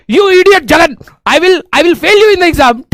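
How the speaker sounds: a furious professor scolding in English; mock angry professor yelling. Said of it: "HOD sir इतना गुस्सा हो गए".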